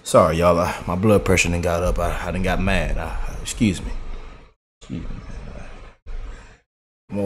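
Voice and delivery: low, softening, winding down; subdued apology. Real, not acted. Co-host excusing his outburst to listeners, subdued apology. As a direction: come down from a rant, sinking to a mutter. Sheepish apology after losing temper.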